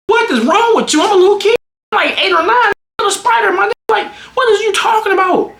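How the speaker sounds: very high, incredulous protest; indignant outburst. Retold or imitated. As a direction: plead your case in a high-pitched rant. Worked-up rant at parent over childhood.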